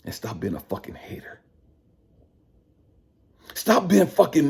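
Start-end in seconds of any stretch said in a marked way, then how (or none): 0.0-1.4 sharp hushed whisper. Acted. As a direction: drop to a breathy whisper to drive it home. Sudden whisper in rant.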